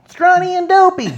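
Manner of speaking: high, loud mocking call; mocking shout. Co-host hollers out a joke name, high mocking shout.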